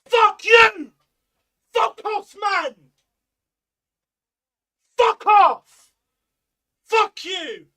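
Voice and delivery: screamed, raging curses at the top of the voice; real fury, yelled way up high and loud; furious shouting. Real, not acted. Fan screaming at the striker on screen, furious shout.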